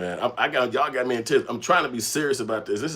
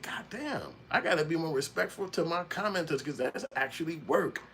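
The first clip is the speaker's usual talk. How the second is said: surprised aside; 7 semitones higher, 6 dB quieter than usual. Retold or imitated. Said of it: "I said".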